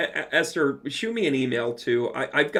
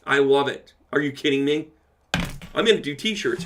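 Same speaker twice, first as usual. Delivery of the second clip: usual speaking voice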